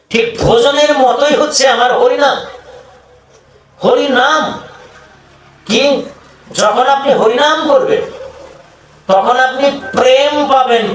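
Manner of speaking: high, impassioned sermon delivery; fervent preaching. Acted. Preacher promising love and liberation through Harinam, fervent preaching. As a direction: proclaim it high and sustained to the congregation.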